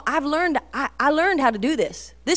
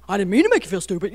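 quick defensive retort; faster than usual. Retold or imitated. Mom accused by daughter, quickly defensive. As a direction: imitate a mom rushing to defend herself. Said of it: "I wanna defend myself".

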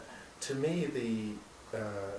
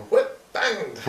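put-on laughing, high Scottish accent; broad Scottish accent; 12 semitones higher, 12 dB louder than usual. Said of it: "strong Scottish accent".

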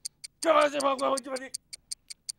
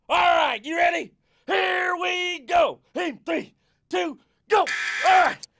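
pumped-up coach; 6 semitones higher, 4 dB louder than usual. Acted. Coach starting workout countdown.

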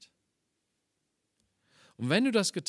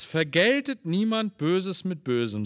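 1 emphatic scripture quote; 3 semitones higher, 8 dB louder than usual. Acted. Quotes Paulus over music.

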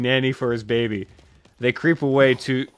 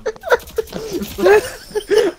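high laughter. Real, not acted. Still laughing at the show.

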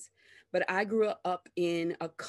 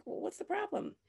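mock listener; 13 semitones higher, 8 dB quieter than usual. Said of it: "you're like".

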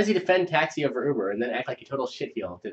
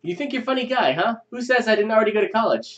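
angry cabbie; 10 semitones higher, 5 dB louder than usual. Acted. Driver confronting Phil.

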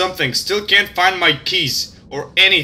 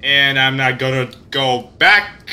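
normal talking voice

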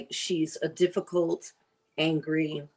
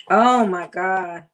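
loud, slow, real delight at the taste; thrilled exclamation; 9 dB louder, slower than usual. Real, not acted. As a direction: savor it out loud, blown away. Tasting the meat, thrilled.

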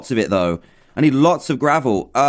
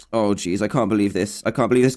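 ordinary talk

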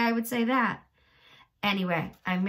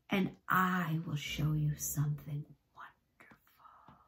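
breathy secret whisper; 3 semitones lower, 5 dB quieter than usual. Acted. Old man tempting James closer, whispered.

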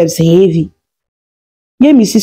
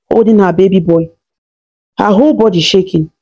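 usual speaking voice

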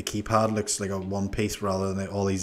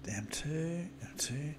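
whispered; 6 semitones higher, 14 dB quieter, slower than usual. Real, not acted. Whispering radio channel check.

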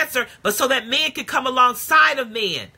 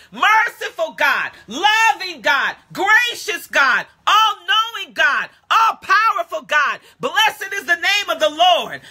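full-force preaching; 7 semitones higher than usual. Real, not acted. Preacher breaking into shouted praise before the congregation. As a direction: let the praise burst out of you, crying out.

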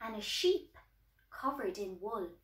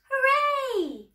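high, loud cheer; 11 semitones higher, 7 dB louder than usual. A narrator's reading. Farm animals celebrating the fire put out, cheering. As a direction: cheer out in triumph. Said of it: "All of the farm animals cheered".

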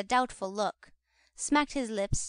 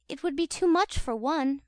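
ordinary delivery, nothing marked

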